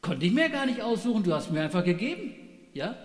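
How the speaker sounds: Adam blaming God and the woman, indignant and whiny; defensive excuse. Pretend. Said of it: "reagiert der Mensch so".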